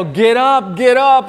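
quick mock yell within a story; retold yell. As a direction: call it out urgently at the screen. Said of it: "I yell".

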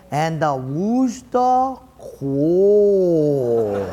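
drawn-out, theatrical storyteller voice; hammed-up musical narrator, very slow; slow dramatic narrator. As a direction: stretch every word like a pageant narrator. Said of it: "a Paquet guy with a beard, and he is saying".

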